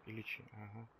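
ordinary delivery

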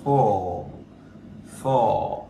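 "four" with a drawn-out, falling O; slow model "four", long O. Shown as a demonstration. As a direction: stretch the vowel in "four". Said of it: "o dài".

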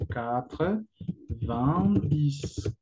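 "quatre, vingt, dix" drawn out, pausing between words; word-by-word pronunciation. Shown as a demonstration. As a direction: teach "quatre, vingt, dix" slowly, one word at a time. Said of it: "La pronunciación".

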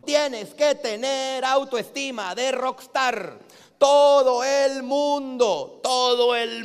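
slow, spaced, emphatic motivational line. Shown as a demonstration. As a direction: drag each word out to drive it home. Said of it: "emphatic slow delivery". (lento y enfático)

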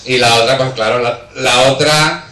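ordinary talk, nothing marked

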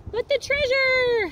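high, loud, slow holler; desperate holler. Acted. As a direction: cry out for the treasure. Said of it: "hollered Braid Beard".

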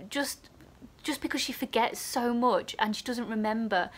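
ordinary delivery

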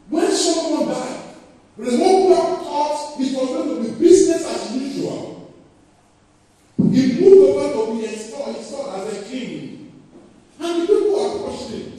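high, loud preacher's build; preaching at full force; fervent preaching. Acted. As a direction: proclaim it to the church.

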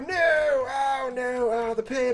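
high hammed-up wailing over the loss; mock lament.

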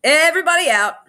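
high, loud order; cried out. A narrator's reading. Harriet clearing the room of children, crying out. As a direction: call out a sharp command to the room. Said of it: "cried Harriet".

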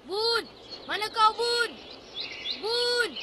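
high search call. Acted. Boy searches for missing Bun, calling out. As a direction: call into the distance, voice held high.

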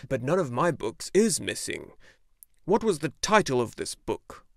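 a lightly voiced gruff old bookseller. A narrator's reading. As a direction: speak as the old shopkeeper.

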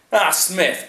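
loud, high, hearty lord's greeting; hearty bark. A narrator's reading. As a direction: bark it out heartily as the lord of the manor. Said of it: "barked Chatterley heartily".

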